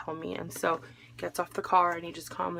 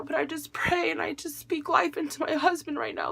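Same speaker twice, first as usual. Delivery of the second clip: praying aloud, pitched up high; a wife praying for her injured husband, raised and pleading; retold prayer; 7 semitones higher than usual. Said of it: "I was praying".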